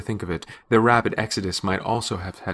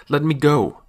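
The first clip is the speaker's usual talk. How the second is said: louder, higher cry; struggling cry; 6 semitones higher, 6 dB louder than usual. A narrator's reading. Pinned woman fights the man holding her, crying out. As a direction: cry out to be released. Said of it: "kicked me several times and cried".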